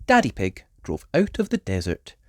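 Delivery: plain reading voice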